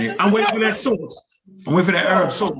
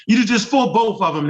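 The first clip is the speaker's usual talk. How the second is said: normal talking voice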